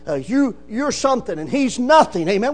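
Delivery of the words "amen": ordinary delivery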